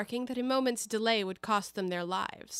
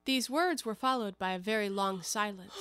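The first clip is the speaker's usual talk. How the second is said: ordinary delivery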